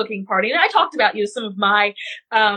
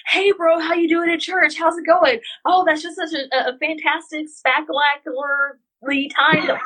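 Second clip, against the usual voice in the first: high, upbeat mock bro chatter; higher-pitched jokey bro greeting; 5 semitones higher than usual. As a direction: ham up a cheerful church guy, pitched high. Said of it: "they'll come to each other like".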